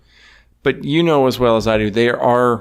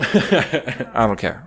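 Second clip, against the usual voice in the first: laughing; much slower than usual. Real, not acted. Laughing after fuck you.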